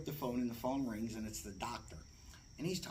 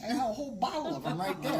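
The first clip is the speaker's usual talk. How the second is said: laughing disbelief; 8 semitones higher, 7 dB louder than usual. Retold or imitated. Retelling her own reply.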